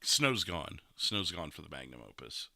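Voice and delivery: ordinary delivery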